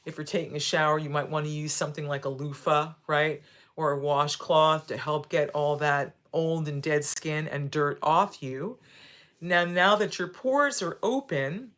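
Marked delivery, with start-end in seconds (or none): none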